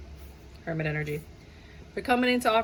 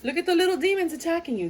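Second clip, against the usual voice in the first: mock alarm; 8 semitones higher than usual. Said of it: "you're gonna be like".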